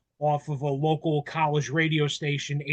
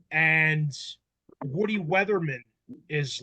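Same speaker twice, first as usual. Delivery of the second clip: ordinary delivery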